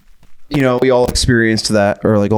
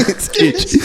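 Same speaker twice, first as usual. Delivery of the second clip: laughing. Real, not acted. Laughing at friend's throat.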